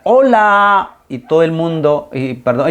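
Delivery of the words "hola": high called-out "Hola"; mock shout. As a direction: call out across the canyon. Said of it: "empezaban a gritar". (grito alegre imitado)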